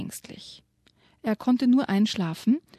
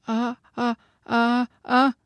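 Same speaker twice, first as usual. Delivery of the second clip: stuttering ah-ah in fright, getting louder; frightened stammer; 3 semitones higher, 5 dB louder, slower than usual. Acted. Yoshi stammering, speechless before the ghost. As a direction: gasp out the sounds, too scared to speak. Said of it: "stammelt Yoshi".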